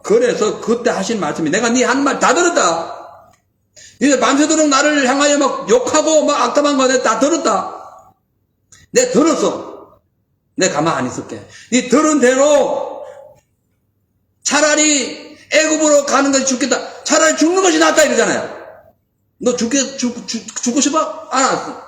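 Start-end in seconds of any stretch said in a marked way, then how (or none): 1.5-18.0 preacher voicing an angry God; stern God. God voiced answering complaints. 19.4-21.8 mock them, then seal it; God answering grumbling Israelites; stern God taunting. God voiced taunting the people.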